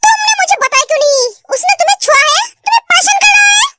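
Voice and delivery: worked-up, protective interrogation; angry. Acted. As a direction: demand answers, building to a sharp "Tell me". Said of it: "गुस्सा क्यों हो रहे हो".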